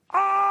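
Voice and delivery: high, loud scream sliding downhill; a toddler screaming as he shoots down the slope; child's scream. Retold or imitated. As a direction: let out a thrilled little-kid shriek. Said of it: "And then I heard".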